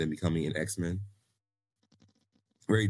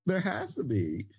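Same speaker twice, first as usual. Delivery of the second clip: slow, much higher theatrical take; high dramatic voice; 12 semitones higher than usual. Pretend. Repeats it in a dramatic voice.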